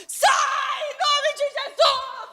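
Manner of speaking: high, loud scream of a terrified man; frightened father yelling at a possessed man; terrified shout. Retold or imitated. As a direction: scream the command out of pure fear. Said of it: "no meio da rua, escândalo".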